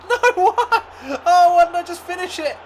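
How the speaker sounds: high, loud laughing outburst at the miss; laughing disbelief. Real, not acted. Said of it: "I can't even talk".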